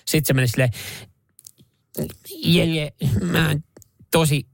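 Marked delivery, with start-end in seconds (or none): 2.4-4.5 slow, measured presidential scolding in a put-on voice; slow, disappointed stateswoman scolding a colleague; Tarja Halonen impression. Host mimics Tarja Halonen scolding Jere, slow and stern. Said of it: "Tarja Halosta imitoida".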